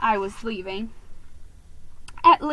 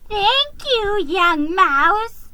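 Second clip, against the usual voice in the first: high, slow, lightly voiced old lady; sweet old mouse calling out her thanks; old lady's high cheery goodbye; 10 semitones higher, 7 dB louder, much slower than usual. A narrator's reading. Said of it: "she murmured".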